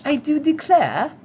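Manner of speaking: high, drawn-out mock upper-class accent; hammed-up first-class accent, slow and high; put-on posh period accent. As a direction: play a rich nineteen-hundreds passenger's accent. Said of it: "the accent rich".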